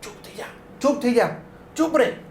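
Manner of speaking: high, hushed, urgent shushing. Retold or imitated. Dolly hushing scared Fiona in the storeroom, high urgent whisper-like. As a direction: hush her quickly so nobody hears. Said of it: "આમ એના મોઢા પર હાથ દબી દે".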